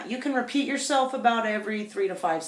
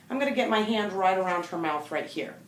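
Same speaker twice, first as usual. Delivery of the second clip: normal talking voice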